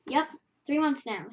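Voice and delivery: ordinary delivery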